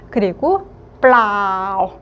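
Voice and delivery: 블라우 drawn long and falling low. Shown as a demonstration. Teacher models the long low tone of 블라우. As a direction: stretch 블라우 and slide it down. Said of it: "장음의 일성, 길게 끌어주면서".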